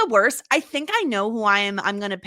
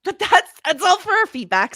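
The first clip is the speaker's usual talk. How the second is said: ordinary talk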